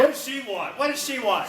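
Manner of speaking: high, panicky demand; high-pitched comic worry; mock alarm. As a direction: ask twice in hammed-up dread. Mock dread at heckler's message.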